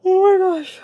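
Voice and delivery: speaking mid-yawn, loud and stretched. Real, not acted. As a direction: let a yawn break through the words. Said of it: "I'm sorry, I'm yawning again".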